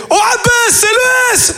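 high hammed-up kid voice; little brother's greeting. Little brother greeting dad by his first name, high. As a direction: squeal the father's name cheekily. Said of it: "il fait".

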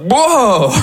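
slow, drawn-out wow of amazement; amazed reader. Acted. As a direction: marvel at a book as if stunned. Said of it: "parfois, je suis le livre, je suis là".

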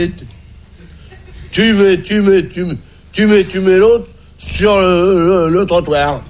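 stutter, repeating words and dragging out the stuck ones; halting stammerer giving advice; stammering man. Acted. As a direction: get stuck on little words, then push through. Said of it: "le bègue, il dit au boiteux".

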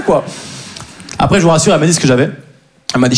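ordinary talk

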